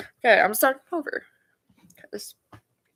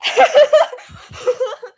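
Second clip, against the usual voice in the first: loud laugh; 13 semitones higher, 8 dB louder than usual. Real, not acted. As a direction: burst of high laughter. Laughing at own joke.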